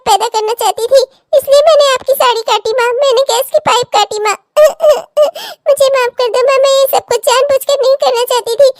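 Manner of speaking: sobbing plea for forgiveness; crying and begging mother to forgive; tearful confession. Acted. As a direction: confess through bursts of crying. Daughter sobs out confession, begs forgiveness.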